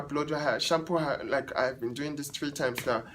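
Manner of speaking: ordinary talk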